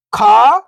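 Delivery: "खा" held long, rising at the end; stretched vowel. Shown as a demonstration. Teacher showing beginners how to read "खा", vowel stretched. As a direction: draw out the aa in "खा" with a lift. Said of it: "कैसे पढ़ेंगे अपन खा को".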